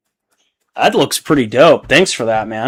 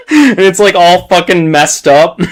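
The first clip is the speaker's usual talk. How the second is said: laughing; 5 semitones higher, 5 dB louder than usual. Real, not acted. Laughing while describing meme.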